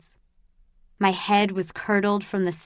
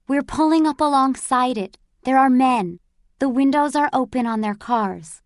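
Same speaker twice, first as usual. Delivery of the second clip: higher and louder, eager lookout; excited; 4 semitones higher, 4 dB louder than usual. Acted. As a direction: call out what you see, thrilled. Boy reports soldiers outside.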